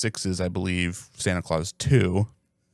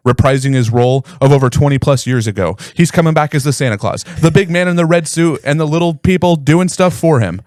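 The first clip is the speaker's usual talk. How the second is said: lightly voiced announcer; loud hype announcement read; 4 semitones higher, 7 dB louder than usual. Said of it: "I should have put that announcer voice on".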